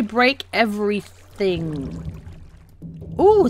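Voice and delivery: ordinary talk, nothing marked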